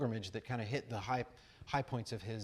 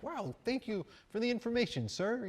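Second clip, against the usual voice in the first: mock-polite thanks; 12 semitones higher, 4 dB louder than usual. Acted polite thanks to the rambler.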